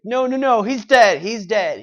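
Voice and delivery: high, loud hurried reassurance; urgent, frantic. Retold or imitated. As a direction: rush to stop his panic. Alfred calming a panicking Bruce.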